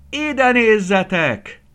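high, loud and drawn-out call. Acted. Farm bird calling the others to look, high and drawn-out.

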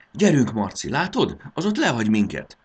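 high, loud, eager urging; keyed-up racing co-driver; urgent cheering. A narrator's reading. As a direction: spur the driver on excitedly. Fairy urges driver in race.

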